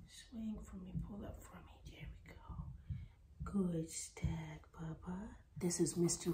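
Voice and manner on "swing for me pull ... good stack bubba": coaxing whisper. Real, not acted. Coaxing puppy into stack.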